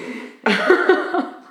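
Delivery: high burst of laughter; loud laugh. Real, not acted. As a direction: let a big laugh out. Laughs at own joke.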